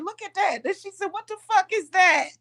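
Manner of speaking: high startled grandma voice; grandma gaping at a spirit. Retold or imitated.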